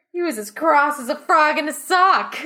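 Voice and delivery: put-on loud, high Australian accent; hammed-up Aussie slang delivery.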